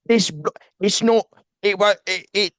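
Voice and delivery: high, loud spluttering British voice; mimicking a British friend stuck on Excel, high and sputtering; British accent. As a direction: sputter like an exasperated Brit. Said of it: "he's British, so he's like".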